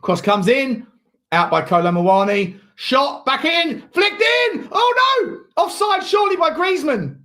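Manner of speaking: live match call building to a loud, high shout; urgent play-by-play erupting as the chance comes; football commentator yelling at a near goal; excited live commentary. Real, not acted.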